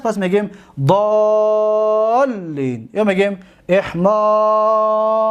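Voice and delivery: ضالین and احمار drawn out slowly, long آ held; slow demonstration. Shown as a demonstration. Teacher illustrates the rule with slow examples. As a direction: stretch the long vowels deliberately. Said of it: "زیرا که علی حده است".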